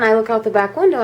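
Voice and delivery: ordinary delivery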